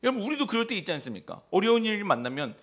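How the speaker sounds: ordinary talk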